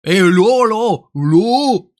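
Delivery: high, hammed-up northern French accent; ch'ti accent. Streamer mimicking guys from the North, ch'ti accent. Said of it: "des mecs qui font".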